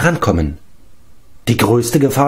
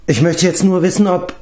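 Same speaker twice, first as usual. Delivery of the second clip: usual speaking voice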